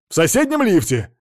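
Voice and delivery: loud, high impatient answer; worked-up loud reply; agitated witness. A narrator's reading.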